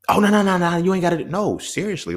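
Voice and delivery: pleading to take the gift back; insistent protest. Retold or imitated. Friend refusing his offer to return it, high insistent protest.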